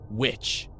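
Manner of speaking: a touch of a snarl on Witch, rising; mild snarl. A narrator's reading. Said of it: "Gracie Lockhart snarled at him".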